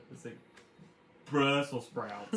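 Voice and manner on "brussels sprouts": Brussels sprouts dragged out very slowly and loud; very slow, effortful Brussels sprouts; child struggling with a word. Retold or imitated. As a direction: labor over Brussels sprouts like a toddler. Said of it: "really hard time saying Brussels sprouts".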